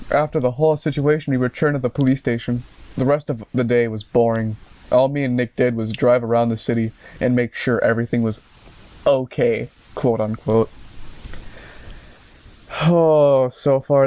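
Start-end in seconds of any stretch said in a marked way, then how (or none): none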